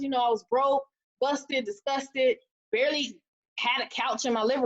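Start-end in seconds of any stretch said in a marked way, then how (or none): none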